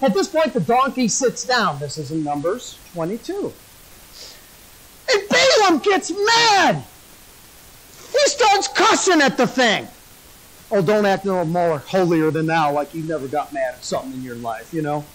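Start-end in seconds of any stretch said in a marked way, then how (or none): 5.1-9.9 full-force preaching. Acted. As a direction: proclaim it with mounting heat. Animated preaching of the story.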